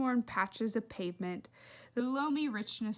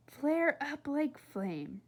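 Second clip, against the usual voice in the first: slow poetic line; 5 semitones higher, much slower than usual. A narrator's reading. Poem line sounding in head.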